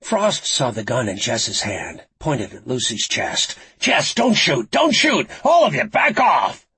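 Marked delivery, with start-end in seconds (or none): 3.8-6.7 high, loud urgent command; urgent shout. A narrator's reading. Frost stops police aiming at Lucy, shouting. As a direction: shout them down before they fire.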